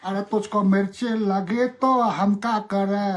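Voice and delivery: sing-song Bihari Hindi accent, brisk. Shown as a demonstration. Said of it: "Bihari की accent".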